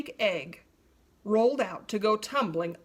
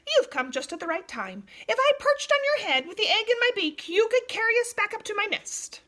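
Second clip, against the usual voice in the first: hornbill pleading; 11 semitones higher, 5 dB louder, faster than usual. A narrator's reading. Hornbill begging the giraffe for help.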